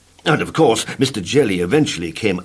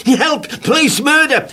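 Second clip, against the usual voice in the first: very high screamed cries; comic terrified scream; 5 dB louder than usual. Acted. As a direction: scream for help. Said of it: "he screamed".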